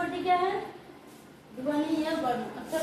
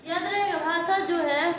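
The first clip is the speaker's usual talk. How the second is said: ordinary talk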